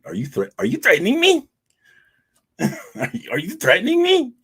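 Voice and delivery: high, loud cartoonish indignation; hammed-up challenge in a comic falsetto; mock outrage. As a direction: squeal it in a silly high voice. Hammed-up reaction to the threat.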